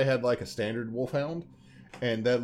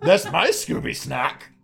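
Scooby-Doo voice; 3 semitones higher, 7 dB louder, slower than usual. Pretend. Mock rich Scooby voice continues.

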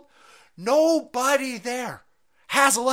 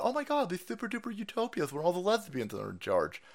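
mock lisp; 5 semitones lower, 7 dB quieter than usual.

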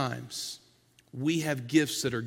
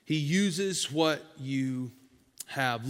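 very slow deliberate; much slower than usual. Shown as a demonstration.

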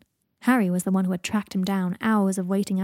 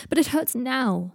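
high, drawn-out whine of pain; whining complaint; 5 semitones higher, 4 dB louder, slower than usual. Acted. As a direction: protest like a suffering patient. Draco whines to Harry about pain.

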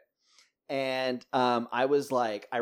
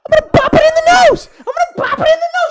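put-on high, loud childish yell; kid yelling; 20 dB louder than usual. Little sister yelling at the bear, loud childish shriek.

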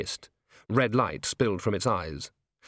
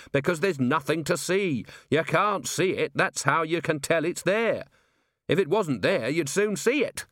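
excited commander, pitched higher; worked-up Vimes; 6 semitones higher than usual. A narrator's reading. Commander explains his invisible clue to his officer, manic. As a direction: press the mad logic home, pitched up.

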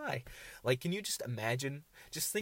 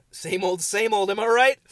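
higher, louder hammed-up joking; mock cheerful; 8 semitones higher, 12 dB louder than usual. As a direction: belt it out like a cheesy punchline. Joking about having no girlfriend.

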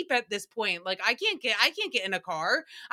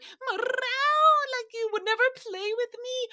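high, slow whiny cat voice; cat voice crying; 14 semitones higher than usual. Retold or imitated. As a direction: whine it like a sulking cat. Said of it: "it sounds like he's crying".

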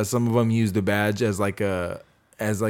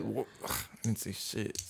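normal talking voice

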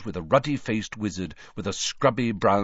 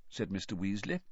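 ordinary delivery

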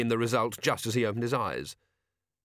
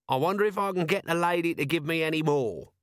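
higher character voice, chancer's musing; shameless scrounger; 7 semitones higher than usual. Acted. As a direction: wonder aloud like a cheeky scrounger.

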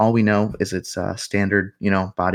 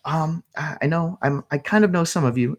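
put-on hesitant young woman; 9 semitones higher than usual. Office woman voiced by DM.

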